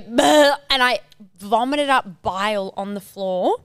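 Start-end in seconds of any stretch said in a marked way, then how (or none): 0.0-0.6 retching sound. Retold or imitated. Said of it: "I was going".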